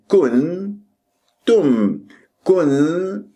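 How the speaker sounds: كنتم split into kun, pause, tum; two separate syllables. Shown as a demonstration. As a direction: break كنتم in two, holding each nasal. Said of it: "كأنه عندك أنت مقطعين".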